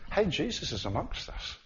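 ordinary talk

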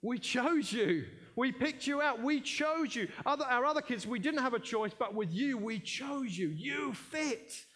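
high, tender, drawn-out reassurance; a lightly voiced parent's loving assurance; loving parents. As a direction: lift it up as adoptive parents telling a child. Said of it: "they would say to her".

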